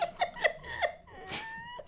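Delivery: very high, laughing squeal. Real, not acted. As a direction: break up laughing at the punchline. Laughs at his own joke.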